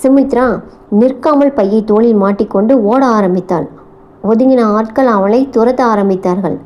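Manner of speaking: usual speaking voice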